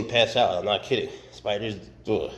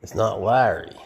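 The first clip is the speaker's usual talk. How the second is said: ordinary talk, nothing marked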